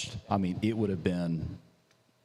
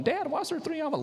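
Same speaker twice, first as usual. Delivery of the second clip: put-on child's voice; faster than usual. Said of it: "your kids are coming home from school going".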